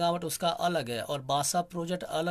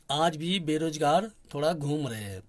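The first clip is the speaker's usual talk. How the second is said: a bit louder and more forceful; speaking up; 4 dB louder than usual. Real, not acted. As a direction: raise your voice a little to be heard. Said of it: "थोड़ी और ज़ोर से बोलूँगा". (थोड़ी ज़ोर से बोलते हुए)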